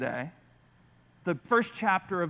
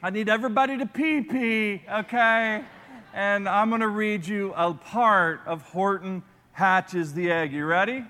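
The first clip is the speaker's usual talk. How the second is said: ordinary delivery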